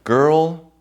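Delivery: put-on Girl said high and loud, drawn out; stress on girl. Shown as a demonstration. Speaker repeats the man's word Girl, high and loud. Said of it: "You understand that?".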